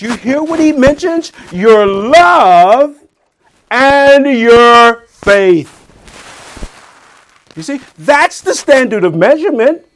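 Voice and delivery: high, loud pulpit preaching that keeps climbing; preaching at full force. Acted. Preacher pressing the church on love, loud and high. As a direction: drive it home to the congregation, loud and high.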